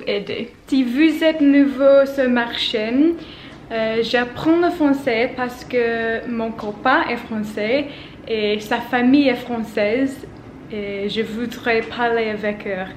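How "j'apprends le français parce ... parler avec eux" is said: usual speaking voice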